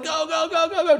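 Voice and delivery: high, excited chant of go; cheering. Retold or imitated. Watcher cheers Brock to the end zone, high and excited. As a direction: cheer someone on to the finish. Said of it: "you're like".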